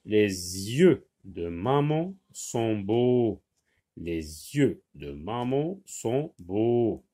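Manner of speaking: slow model reading with a z linking "Les yeux"; teacher's slow, careful liaison on "Les yeux"; liaison demonstrated. Shown as a demonstration. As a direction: read it slowly, sounding "Les yeux" as lé-zyeu. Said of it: "on fait la liaison S avec Y, ça se lit zy".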